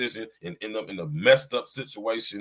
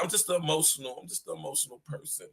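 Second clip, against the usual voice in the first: mocking women; 2 semitones higher, 10 dB quieter, faster than usual. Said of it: "y'all hear a lot of chicks say that".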